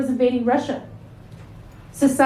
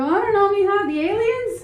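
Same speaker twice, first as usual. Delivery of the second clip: higher, unsure questioning tone; unsure guess trailing up; bewildered mom; 8 semitones higher than usual. Retold or imitated. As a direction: mom answering daughter's question. Mother answering daughter, bewildered.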